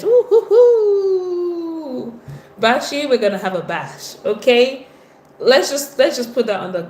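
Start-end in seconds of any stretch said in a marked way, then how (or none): 0.0-2.2 long, high, loud hooting cheer; drawn-out birthday woohoo; celebratory whoop. Real, not acted. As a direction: hoot with glee and hold the last note. Celebrating a viewer's birthday date.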